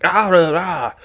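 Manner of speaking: high mock punk rock shout; mock punk preacher; punk rock yelp. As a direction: yelp it like a punk singer fronting a band. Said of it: "sounds like a fucking punk rock singer".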